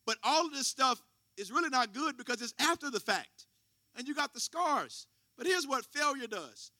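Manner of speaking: mild sermon swell; preaching. Acted. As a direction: build it up for the pews. Preaching about failure.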